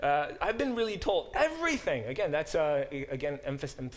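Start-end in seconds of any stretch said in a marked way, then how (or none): 0.0-2.0 "everything" pushed high and loud, falling at the end; emphatic rendering. Shown as a demonstration. Teacher trying to voice the emphasis, with "everything" high and loud. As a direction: land hard on "everything", higher and louder. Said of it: "I don't know how to emphasize this word".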